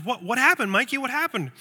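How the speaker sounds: mother surprised by son's excitement. Retold or imitated. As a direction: catch her off guard. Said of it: "Mom responds with surprise and says".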